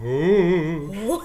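ghost voice. Shown as a demonstration. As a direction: open hooting ghost ooh, laughing. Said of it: "More like".

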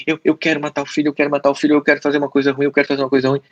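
quick, even, looping repetition; nagging intrusive thought. Acted. Psychologist voices an intrusive thought on repeat, nagging monotone. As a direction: rattle it off like a stuck record.

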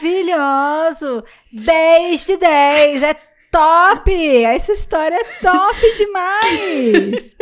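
slow, gushing mock enthusiasm; ironic praise. Host demoing the ironic episode to co-hosts, sarcastic. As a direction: pile up fake compliments, every one sarcastic. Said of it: "eu tô mudando a minha entonação".

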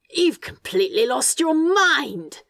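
high, slow, drawn-out outburst; aghast disbelief. Acted. Demon telling the angel off, aghast. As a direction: exclaim it in scandalised disbelief.

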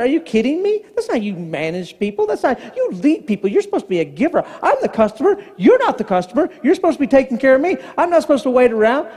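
indignant lecture with sharp pitch jumps; acted-out condescending scolding; angry pastor mode. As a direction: preach down at him, punching key words. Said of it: "but it's angry".

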